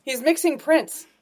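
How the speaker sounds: high, loud delighted ribbing; teasing laugh-edged exclamation; excited teasing. Real, not acted. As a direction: gleefully call out his mixed prints. Mocking friend's outfit.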